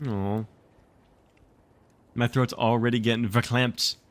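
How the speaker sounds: usual speaking voice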